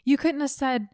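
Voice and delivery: mock exasperation. Mock scolding the tipster.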